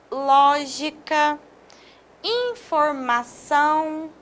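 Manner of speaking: lógica and informação said slowly, stressed syllables leaned on; slow stress demo. Shown as a demonstration. Teacher listing accented words for students, slow and careful. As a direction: read each word out slowly for the class. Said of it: "Vejam bem, essas palavras".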